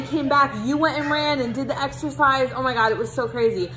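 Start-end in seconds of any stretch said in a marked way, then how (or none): none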